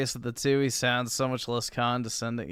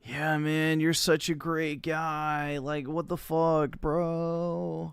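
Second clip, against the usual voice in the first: slurring, slow, higher imitation of a drunk; drunk guy voice; 5 semitones higher, much slower than usual. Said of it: "this literally just sounds like a drunk guy".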